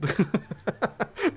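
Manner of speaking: a sudden high amused laugh. Real, not acted. Laughing at his poorly armed army. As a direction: laugh out at the absurdity.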